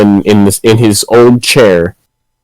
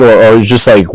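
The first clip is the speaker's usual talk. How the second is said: usual speaking voice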